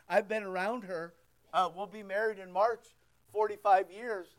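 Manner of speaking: ordinary talk, nothing marked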